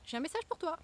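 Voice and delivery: a delivery man announcing at the door; knocking postman. Pretend. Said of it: "un facteur qui va venir taper à ta porte".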